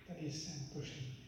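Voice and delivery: softly under the breath. Shown as a demonstration. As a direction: say it half-voiced so the neighbour won't hear. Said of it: "polohlasně". (polohlasně, skoro šeptem)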